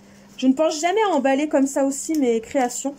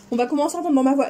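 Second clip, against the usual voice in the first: ordinary delivery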